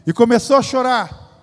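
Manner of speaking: the tears told with pulpit force; rising preaching emphasis. Acted. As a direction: push it out to the back pews. Repeated line, louder third take.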